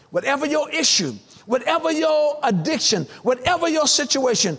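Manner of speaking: high, stretched-out shouted exhortation; slow, ringing pulpit climax; full-force preaching. Real, not acted. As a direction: draw out each word as you exhort the congregation.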